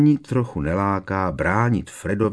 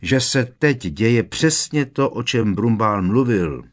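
higher, louder, insistent warning; raised voice; 5 semitones higher, 6 dB louder than usual. A narrator's reading. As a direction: press the point home with a raised voice. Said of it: "pokračovala zvýšeným hlasem". (naléhavě, zvýšeným hlasem)

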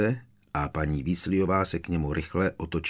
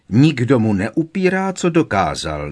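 louder, higher objection; raised voice; 5 semitones higher, 8 dB louder than usual. A narrator's reading. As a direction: push back with a raised voice. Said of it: "namítla paní Weasleyová zvýšeným hlasem".

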